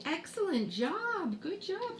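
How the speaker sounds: high, sing-song praise; teacher's praise. Acted. Teacher praising a pupil's attempt at spelling, high and encouraging. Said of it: "you would say".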